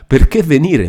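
high-pitched, incredulous repeat; indignant echo. A narrator's reading. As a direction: throw the question back, hurt. Renzo throws her question back.

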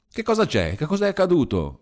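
high, startled questions; alarmed exclamation. A narrator's reading. Sister startled by her brother's shouting, high and alarmed. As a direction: ask in sudden alarm. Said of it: "esclamò la sorella".

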